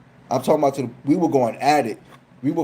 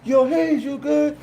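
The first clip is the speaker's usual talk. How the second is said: calling out; 12 semitones higher, slower than usual. Retold or imitated. Neighbors across the building calling to him, a high mock holler. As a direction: holler his name from far off.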